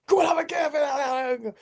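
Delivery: high, drawn-out yell; mock enraged shout. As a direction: bellow it long and high, re-enacting rage. Re-enacts own livid shouting.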